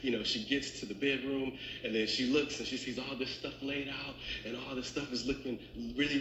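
ordinary talk, nothing marked

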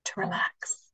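whispered, fading to nothing; soft spa whisper. Acted. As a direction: breathe the words out softly. Whispered cue in relaxation.

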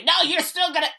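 high whiny mimicry; mock objector. Imitating people who say he'll still get infected, high mocking. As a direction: squeak out the doubter's line. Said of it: "Some people say".

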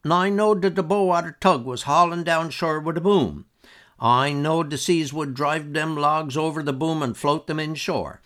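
lightly voiced bragging old man; old Uncle George. A narrator's reading. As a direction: brag like a spry old man pleased with himself. Old uncle brags about logs.

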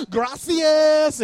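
high, loud hammed-up thanks; overly friendly. Customer thanking taco staff, overly friendly. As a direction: call it out high and cheery. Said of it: "I'm always friendly when I go in".

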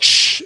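sharp imitated shushing hiss; annoyed shush. Son on the phone shushing his dad, annoyed shush. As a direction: shush someone who is interrupting you. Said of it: "he shushed me".

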